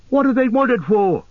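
high-pitched orc question; high, demanding orc voice; clamouring orc. A narrator's reading. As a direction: pipe up sharply from the orc crowd.

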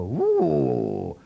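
mock envious sigh. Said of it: "You girls really sit around and go".

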